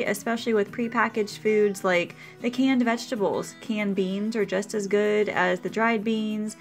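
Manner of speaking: usual speaking voice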